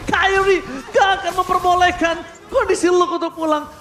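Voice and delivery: loud fast play-by-play hype; esports caster. Acted. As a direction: call the teamfight at full energy. Hyped play-by-play casting.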